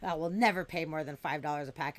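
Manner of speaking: ordinary talk, nothing marked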